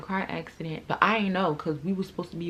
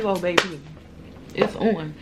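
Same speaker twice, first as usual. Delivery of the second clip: ordinary talk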